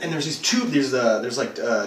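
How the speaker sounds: ordinary talk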